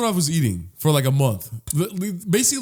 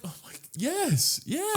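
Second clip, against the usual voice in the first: higher, slow, soft delight; 11 semitones higher, 7 dB quieter, slower than usual. Retold or imitated. Customer delighted at the drive-thru offer. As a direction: gush over the free ranch. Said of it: "you'd be like".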